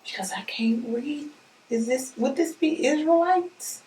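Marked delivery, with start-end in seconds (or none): 0.0-1.3 whispered aside. Real, not acted. Aside while squinting at label.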